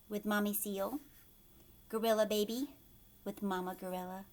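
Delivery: plain reading voice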